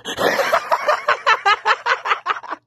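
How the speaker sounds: high wild cackle; troll cackle. Pretend. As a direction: cackle at their reaction. Trolling strangers in voice chat.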